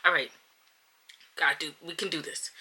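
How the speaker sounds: ordinary delivery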